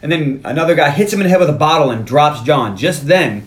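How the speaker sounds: ordinary talk, nothing marked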